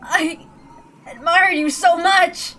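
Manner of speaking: high, slow sobbing cry; tearful cry. Acted. Dying George cries to his beloved uncle, sobbing. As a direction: cry out through tears.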